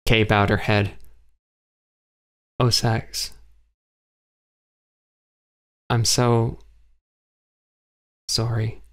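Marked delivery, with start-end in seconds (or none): 2.6-8.8 sad. A narrator's reading. Kay grieving, head bowed.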